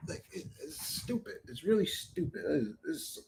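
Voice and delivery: whispered, barely voiced grumble; muttered disgust. Real, not acted. Streamer grumbling about fans, a muttered aside. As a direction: mutter it under your breath.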